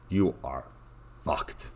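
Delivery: dropped to a near-mutter; quiet resigned repeat. Acted. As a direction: say it again softly, resigned.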